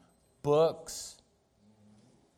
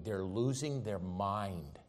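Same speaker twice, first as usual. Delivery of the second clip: soft, slow repeat; 5 semitones lower, 6 dB quieter, slower than usual. Acted. Preacher repeats line, soft then loud.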